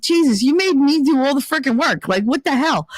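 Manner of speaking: high-pitched exasperated complaint; retold exasperation. Retold quarrel, mad at date.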